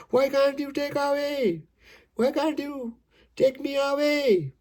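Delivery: high, sustained wailing recitation; acted crying plea. Poem's man begs to be taken away, high acted wail. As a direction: plead in a long, high chant. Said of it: "he cried".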